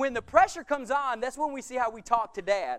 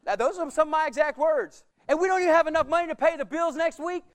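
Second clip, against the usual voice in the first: loud, higher accusing protest; angry at God; 5 semitones higher, 6 dB louder than usual. Retold or imitated. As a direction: demand an answer in anger. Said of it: "and I was mad".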